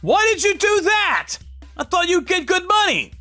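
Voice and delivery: very high, exclaimed disbelief; appalled. Acted. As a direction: challenge your brother in shock. Shocked at brother's theft.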